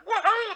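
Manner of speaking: high mock cheer from the audience; imitated audience cheer. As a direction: mimic a crowd's welcoming whoop. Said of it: "there was that cheer".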